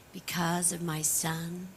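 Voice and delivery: ordinary talk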